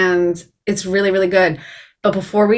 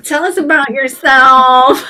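hammed-up, drawn out, higher and louder; mock annoying question; 6 semitones higher, 5 dB louder, slower than usual. Host teasing guest with the stock opener, mock annoying. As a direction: play up the cheesy interview question. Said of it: "do the annoying, like".

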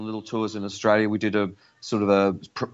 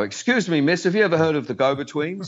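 higher, quick showman's approach; mock polite pitch; 6 semitones higher than usual. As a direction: play the cheeky promoter to strangers. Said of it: "go up to all these women and go".

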